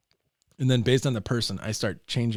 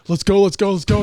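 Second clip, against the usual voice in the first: loud hurried dad; 9 semitones higher, 12 dB louder than usual. Retold or imitated. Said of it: "The guy's just like".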